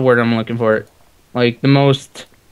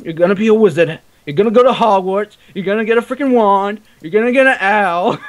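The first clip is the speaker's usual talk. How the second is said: bad English accent; 7 semitones higher than usual. Shown as a demonstration. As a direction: belt it out in a terrible English accent. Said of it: "I had the worst English accent".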